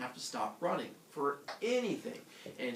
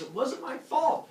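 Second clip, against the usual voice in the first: high, loud mock-panicked protest; Leto; 10 semitones higher, 7 dB louder than usual. Girl protests innocence to goddess, high wail. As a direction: plead it slowly, high and loud.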